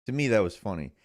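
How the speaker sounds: ordinary talk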